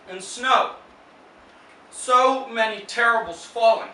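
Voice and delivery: loud, high poetry reading with long pauses; poem recital. Acted. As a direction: declaim it in short, weighted phrases. Reads own poem aloud.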